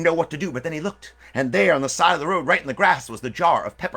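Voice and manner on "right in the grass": plain reading voice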